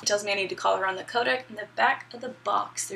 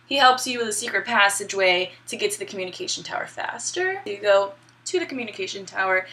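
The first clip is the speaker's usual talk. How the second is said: ordinary talk, nothing marked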